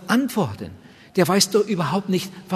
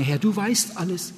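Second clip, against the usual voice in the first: raised, heartfelt praying voice; lightly performed sample prayer, pitched higher; earnest prayer; 4 semitones higher than usual. Shown as a demonstration. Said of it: "Ich darf ihm sagen".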